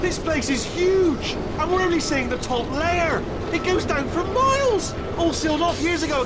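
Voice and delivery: excited, high-pitched calling; awed calling out. Acted. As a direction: holler it across a vast space. Calling out over a huge drop.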